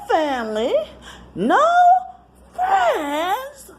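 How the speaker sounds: very high, loud sneering imitation; mocking sister. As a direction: mimic the twin sister throwing it in her face. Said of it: "Her talking like that".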